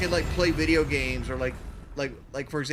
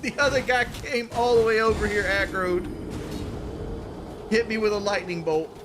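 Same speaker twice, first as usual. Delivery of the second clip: worked-up gamer rant; 6 semitones higher than usual. Real, not acted. Raging about the game.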